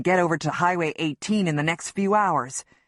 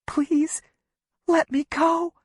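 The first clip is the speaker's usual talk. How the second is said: high, breathless begging; frightened plea; 9 semitones higher, slower than usual. A narrator's reading. Wife pinned against a tree, begging him to let go. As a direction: beg to be released. Begging after whispering please.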